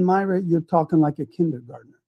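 ordinary delivery

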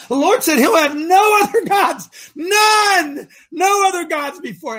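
loud, high preaching cry; preaching shout. Real, not acted. Radio coach preaching to listeners, loud and high. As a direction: proclaim it with fervor.

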